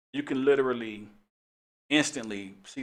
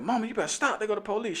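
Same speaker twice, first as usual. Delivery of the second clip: higher, pleading daughter's voice; worried daughter; 10 semitones higher than usual. Retold or imitated. Daughters urging mama to stop, pleading higher. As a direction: beg your mother to quit. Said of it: "They was like".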